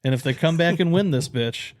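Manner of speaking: normal talking voice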